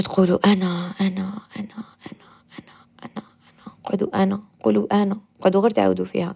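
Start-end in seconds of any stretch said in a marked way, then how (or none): 0.5-3.2 very soft repetition, trailing into whisper; fading mantra. Shown as a demonstration. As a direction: start soft and fade almost to a breath. Models a repeated mantra, eyes closed.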